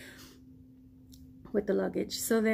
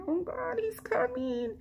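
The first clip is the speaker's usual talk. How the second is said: much higher, hushed flutter of nerves; nervous excitement; 13 semitones higher than usual. Retold or imitated. She spots him arriving and turns away, higher and hushed. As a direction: squeal it under your breath. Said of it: "I'm like".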